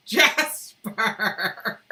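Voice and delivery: loud laugh into a name. Real, not acted. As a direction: burst out laughing. Laughs at viewer's comment.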